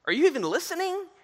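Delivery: high, exasperated demand; indignant. Retold or imitated. Drowned man confronts God, asking if he listened: high exasperated cry. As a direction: throw the question at God in disbelief.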